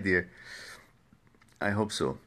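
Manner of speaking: ordinary talk, nothing marked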